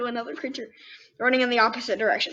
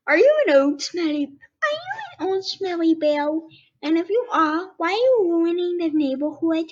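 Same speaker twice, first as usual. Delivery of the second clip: squeaky little bunny asking, higher pitch; 6 semitones higher, 3 dB louder than usual. Acted. Bunny questions the skunk, high and curious. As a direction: play a small excited bunny, pitched up.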